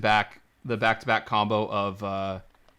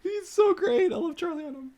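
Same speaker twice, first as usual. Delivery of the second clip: high, squealing fan gush; 5 dB louder than usual. Real, not acted. Gushing over Charlie Hunnam in a squeal of delight.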